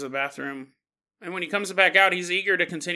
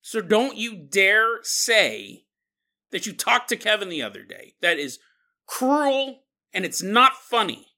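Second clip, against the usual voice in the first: acted stern warning, raised and emphatic; madder and madder; 3 semitones higher, 4 dB louder than usual. Tammy warning Miles not to joke about Kevin, angrily. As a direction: play her scolding, with pauses before the hard words. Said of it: "making her madder and madder".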